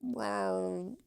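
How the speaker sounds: high rising cat mutter; cat grumbling. Acted. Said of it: "she's always kinda like".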